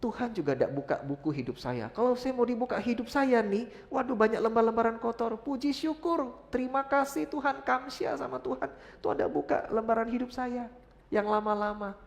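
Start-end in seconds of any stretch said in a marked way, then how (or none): 1.9-11.9 high, loud joyful preaching burst; fervent praise. Acted. Preacher rejoicing to the congregation, high and loud.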